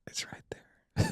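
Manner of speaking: breathy whispered reply; hushed retold whisper. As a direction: whisper it toward the officer. Whispering answer to police officer.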